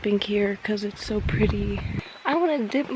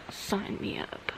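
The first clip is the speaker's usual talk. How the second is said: whispering; 11 dB quieter than usual. Real, not acted. Whispered aside on quiet trail.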